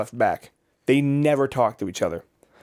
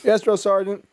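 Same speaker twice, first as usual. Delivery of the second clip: higher barked recruit answer; barked military recruit reply; 8 semitones higher than usual. Retold or imitated. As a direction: snap back like a recruit. Recruit responding.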